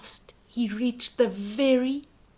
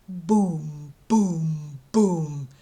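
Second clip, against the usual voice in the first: low booming footsteps; 7 semitones lower, much slower than usual. A narrator's reading. Giant's footsteps.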